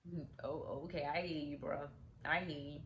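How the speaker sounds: low, soft, half-hearted agreement; low noncommittal acknowledgment. Retold or imitated. Friend hearing Mac has changed his life, quiet noncommittal agreement. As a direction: drop low and quiet, just going along.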